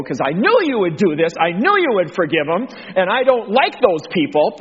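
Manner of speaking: lightly acted grumbling at God; mock complaint. Jonah objecting to God forgiving those people, mildly indignant.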